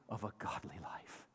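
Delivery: whisper. Real, not acted. Dropped to a hush.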